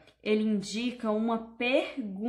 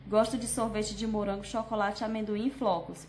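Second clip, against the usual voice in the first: rushed reading. Shown as a demonstration. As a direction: list run together without comma pauses. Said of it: "Eu não posso ler desse jeito, correndo".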